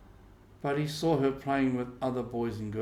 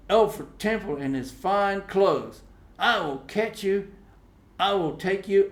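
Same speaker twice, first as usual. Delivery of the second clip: jealous Tom; 10 semitones higher, 8 dB louder than usual. Acted. Tom's jealous threat.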